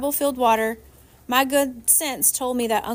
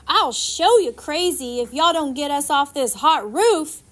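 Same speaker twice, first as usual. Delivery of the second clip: louder, different voice, threatening; angry round woman; 4 semitones higher, 4 dB louder than usual. A narrator's reading. Woman stranded on the roof threatening the boat, angry voice. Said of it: "the round woman screamed".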